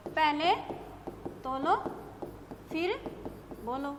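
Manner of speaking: each word separated by long pauses; word-by-word dictation. Shown as a demonstration. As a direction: dictate for the students to write. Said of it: "एक वाक्य मैंने लिख दिया".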